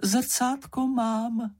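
very high, drawn-out little voice; voice from darkness. A narrator's reading. Slečna Ferka reports from the darkness, slow high voice. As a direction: pipe up slowly, high and small. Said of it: "ozval se hlas z temnoty".

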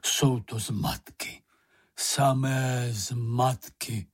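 very slow, drawn-out grumble; ponderous grumbler in no hurry; grumbling. A narrator's reading. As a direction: grumble each word out at length. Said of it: "zabručel".

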